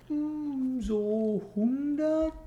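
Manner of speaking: drawn out, thinking aloud; slow hesitant. Real, not acted. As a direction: stretch it like you're guessing. Pondering guess. (zögernd gedehnt)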